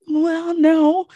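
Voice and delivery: sheepish client. Retold or imitated. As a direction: admit it like a caught client. Client answers about her ingrowns.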